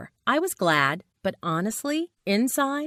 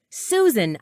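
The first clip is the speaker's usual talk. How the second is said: louder, higher call of her name; yell; 4 semitones higher, 6 dB louder than usual. A narrator's reading. Narrator as friend bursts into office, yell. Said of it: "I yelled".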